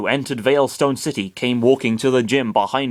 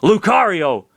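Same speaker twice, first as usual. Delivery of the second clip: man's shout; 7 semitones higher, 5 dB louder than usual. A narrator's reading. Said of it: "the man shouted".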